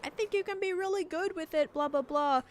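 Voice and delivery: high-pitched mock of an encouraging husband; imitating husband. Streamer retelling husband's advice on the tool, high mocking voice. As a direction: pitch it right up, quoting your husband. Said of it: "he's like".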